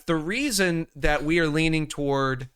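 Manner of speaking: normal talking voice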